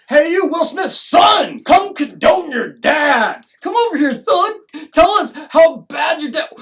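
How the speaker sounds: high, loud mock bullying; hectoring shout. Society ordering bystanders to take a side, hectoring shout. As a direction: yell demands like a mob hauling someone over.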